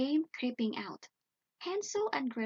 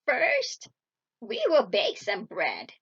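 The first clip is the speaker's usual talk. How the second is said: scheming witch; 6 dB louder, slower than usual. A narrator's reading. Witch plans to trick Gretel, loud scheming voice. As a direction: announce it loudly and slyly. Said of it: "said the witch".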